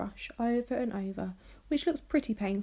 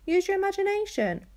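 insistent; 11 semitones higher, 5 dB louder than usual. Acted. Zelda urging Felix.

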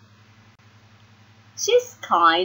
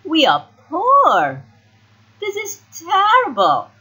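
high, loud wail of complaint; dismayed, with big swoops in pitch; dramatic dismay; 9 semitones higher, 4 dB louder than usual. A narrator's reading. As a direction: lament it like a spoilt sister. Sisters lament losing their money.

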